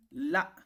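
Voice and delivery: "la" said high, drawn out. Shown as a demonstration. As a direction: lift the voice on "la". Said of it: "on fait donc une élévation".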